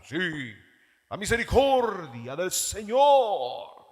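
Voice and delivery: slow, loud pompous preaching tone; impostured preacher voice. Shown as a demonstration. Pastor mocking a TV preacher, slow and booming. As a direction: stretch it out like a showy TV pastor. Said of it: "toda impostada la voz".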